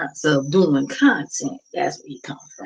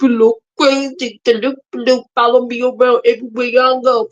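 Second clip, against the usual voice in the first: ordinary talk, nothing marked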